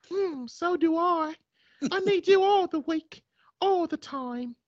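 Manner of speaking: put-on woman's British accent. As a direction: purr it back like a smitten English lady. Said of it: "man and a woman accent".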